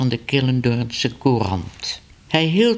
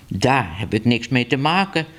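ordinary delivery, nothing marked

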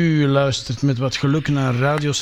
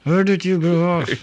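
talking with mouth closed. Shown as a demonstration. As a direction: question through still lips. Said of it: "let's try it like this".

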